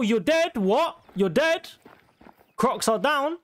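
high-pitched mocking taunts at the croc; taunting. Real, not acted. Hunter taunting a charging crocodile in the game, high mocking goad. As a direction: goad it to come at you.

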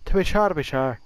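put-on Irish accent. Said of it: "I'll do an accent in the next one".

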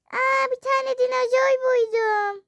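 high and loud delight; thrilled to find it; excited discovery. Acted. Daughter excitedly showing her mother the dinosaur.

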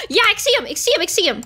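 gasp, then high eager repeats; excited discovery. Real, not acted. Searching the dark cellar, she finally sees the key, thrilled.

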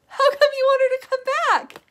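playful puppeteer surprise; mock surprise. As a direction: tease the kids with surprise. Playful surprised question to kids.

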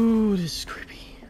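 hushed spooked whisper; spooked and shaky. Real, not acted. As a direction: breathe it out, unnerved. Spooked by creepy game place.

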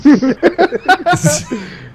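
very high laughing burst; high burst of laughter. Real, not acted. Laughing at friend laughing at her, high burst.

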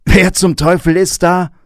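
wütender alter Mann; angry old man shout. Acted. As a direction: roar it as the irritated old man. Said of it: "fragt der alte Mann".

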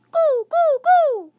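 high, loud hooting monkey calls; monkey hoots. A narrator's reading. Langurs hooting a warning to the children, high and loud. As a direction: hoot it out like a frightened langur.

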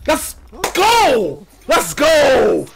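exultant shouting; victory shout. Real, not acted. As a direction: bellow it in celebration. Celebrating the win.

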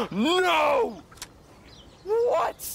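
gasp, then a very high cry; high-pitched, stunned yelp; shocked outcry. Real, not acted. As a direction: shriek in disbelief. Gasps as the catch is lost.

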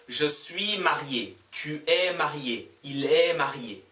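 "je suis marié, tu es marié, il est marié" very slow; slow conjugation drill. Shown as a demonstration. Teacher drilling the conjugation very slowly. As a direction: spell out each person slowly. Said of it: "이런 식으로 하시면 돼요".

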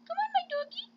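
squeaky, very high coaxing; high pet-talk voice. Pretend. Player coaxing found dog home, squeaky pet talk. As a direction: coo to a pet way up in falsetto.

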